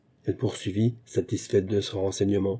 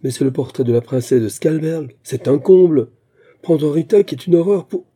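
higher, louder, incredulous amusement; raised voice, scoffing in amused disbelief; highly amused exclamation; 5 semitones higher, 7 dB louder than usual. A narrator's reading. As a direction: exclaim as if it's hilarious. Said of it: "puis rit aux éclats".

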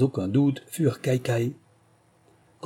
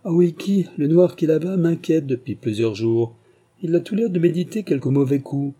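a lightly raised, uneasy captain's voice; wary captain; 5 semitones higher, 4 dB louder than usual. Acted. Captain Hansen warning his mate about a man, uneasy. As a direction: warn him with a higher, tense voice. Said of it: "dit Hansen au second".

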